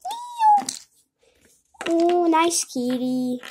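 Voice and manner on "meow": high, shouted kitten cry; crying cat meow. Pretend. Kid voicing the game kitty, high crying meow. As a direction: yowl like an upset kitten.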